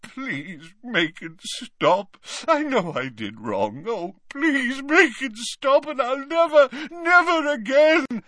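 high, wailing plea rising in desperation; Dumbledore sobbing. A narrator's reading. Dumbledore begging for it to stop, sobbing. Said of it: "he sobbed".

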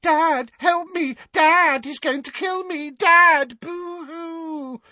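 loud falsetto mock crying; jeering imitation of a terrified cry for help; high-pitched whimpering. A narrator's reading. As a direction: wail for Dad in a mocking squeal. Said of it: "adopted a high-pitched whimpering voice".

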